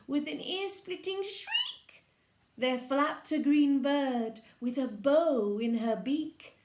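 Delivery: ordinary delivery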